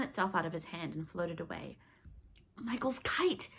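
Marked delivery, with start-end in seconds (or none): 2.6-3.5 whispered and listless; without interest. A narrator's reading. Exhausted Peter noticing the kite, whispered without interest. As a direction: breathe it out as if it doesn't matter. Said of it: "Peter said without interest".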